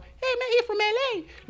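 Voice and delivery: high falsetto mock yell, kept soft; imitated coworker calling out. Coworker passing the news to another, mock yell in falsetto. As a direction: fake a yell in a high, softened voice. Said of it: "le gritó".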